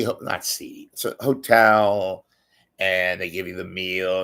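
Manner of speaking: normal talking voice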